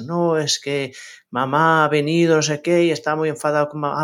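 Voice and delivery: lightly voiced small girl, slowed down; little daughter's voice. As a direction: voice your young daughter explaining her grievance. Mother voicing her small daughter.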